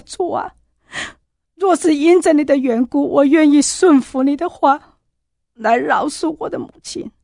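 weeping prayer of forgiveness; tearful cry. Retold or imitated. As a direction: cry out to God through tears. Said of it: "我哭着，我喊着".

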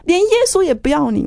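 high, cutting angry jab; a bitter retold outburst at a brother; harsh retort. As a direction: spit it out to wound him. Lashing out at her brother.

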